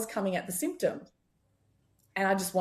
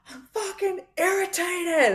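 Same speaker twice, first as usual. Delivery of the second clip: much higher, slow, annoyed voice of her skin; irritated skin voice; 12 semitones higher, 4 dB louder, slower than usual. Retold or imitated. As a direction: voice the skin as a fed-up person. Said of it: "the voice of the skin was like".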